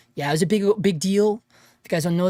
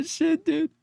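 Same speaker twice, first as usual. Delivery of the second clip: ordinary delivery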